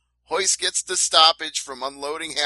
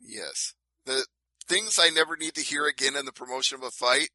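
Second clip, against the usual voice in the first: usual speaking voice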